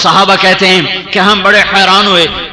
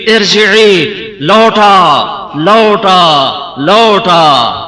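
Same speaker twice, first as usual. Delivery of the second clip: slow, lingering لوٹا; drawn-out plea; much slower than usual. Shown as a demonstration. Preacher explaining the word, slow drawn لوٹا. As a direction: stretch لوٹا out like a longing call. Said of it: "کیا مطلب؟".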